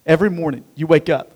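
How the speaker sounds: ordinary delivery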